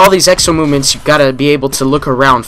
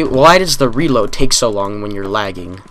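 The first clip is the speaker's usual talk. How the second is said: normal talking voice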